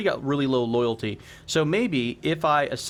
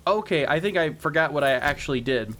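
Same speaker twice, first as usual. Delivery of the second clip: normal talking voice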